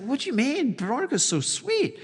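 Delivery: high, incredulous imitation of Jim; puzzled Jim. Jim doubting the broker about Veronica, high and puzzled. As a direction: play Jim's disbelief up high. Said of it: "Jim's like".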